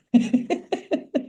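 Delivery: a quick high laugh; short laugh. Real, not acted. Laughing at what she heard on TV, a quick high laugh. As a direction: laugh it off, amused. Laugh after her disbelief.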